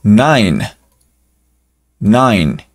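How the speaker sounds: "nine" with the I of the letter name, silent E. Shown as a demonstration. Teacher models "nine" for the class. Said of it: "solita sería I".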